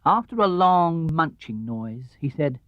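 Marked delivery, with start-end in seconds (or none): none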